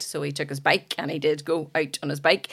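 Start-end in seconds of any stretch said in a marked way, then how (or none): none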